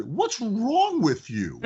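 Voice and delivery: slow, drawn-out mock reproach; incredulous scolding. Owner teasing a complaining customer, slow mock reproach. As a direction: stretch it out, teasing disbelief. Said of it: "I said".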